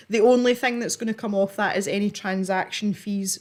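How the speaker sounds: ordinary talk, nothing marked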